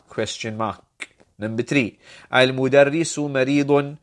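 usual speaking voice

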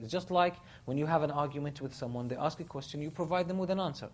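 ordinary talk

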